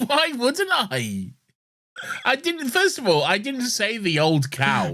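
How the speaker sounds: high-pitched, amused self-defence; playfully outraged, voice shooting up; indignant protest. Real, not acted. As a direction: squeak out a drawn protest.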